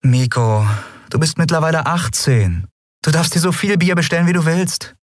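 high-pitched, forced patience; strained patience. A narrator's reading. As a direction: explain it while holding back exasperation. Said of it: "bemühte sich um Geduld".

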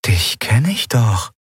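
slow recognition. A narrator's reading. She suddenly recognises a man.

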